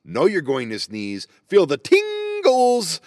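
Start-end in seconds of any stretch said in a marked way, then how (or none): 1.5-3.0 high drawn-out squeal; high squeaky voice. Retold or imitated. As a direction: stretch it high like a tingle. Describing sneeze build-up.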